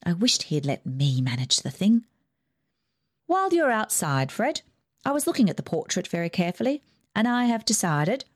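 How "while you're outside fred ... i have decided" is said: put-on higher, imperious old aunt; Aunt Ida's voice. Rich aunt dismissing the family, higher and bossy. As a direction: lift the pitch and command like a rich aunt.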